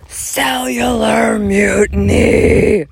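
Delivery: high, very slow, stretched mock shout; faux yell. Declaring her rebirth to viewers, slow faux yell. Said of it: "faux yell".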